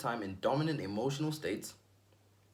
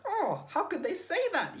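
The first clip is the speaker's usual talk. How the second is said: much higher, slow, hammed-up hurt feelings through a laugh; mock hurt whine; 4 dB louder, slower than usual. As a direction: play the offended friend, laughing. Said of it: "You're like".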